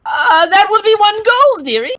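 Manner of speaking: put-on high, cackling old witch voice. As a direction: voice the greedy old witch selling pies.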